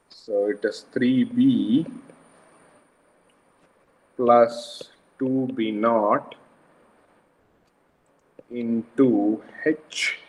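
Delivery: slow, word by word dictation. Shown as a demonstration. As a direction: spell out the formula slowly. Dictating formula slowly.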